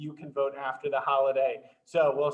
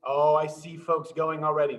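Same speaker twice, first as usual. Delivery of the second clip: ordinary talk, nothing marked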